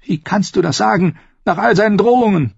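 high, indignant woman's voice; angry. A narrator's reading. Sister disputes brother's defense of Tyrion, angry. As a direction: protest angrily, building in volume. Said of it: "Wütend verzog sie den Mund".

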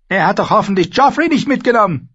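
high, loud, imperious queen voice; Cersei, haughty queen. Acted. Queen lecturing Sansa at the feast, haughty and loud. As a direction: play the proud queen lecturing the girl. Cersei asks about Joffrey.

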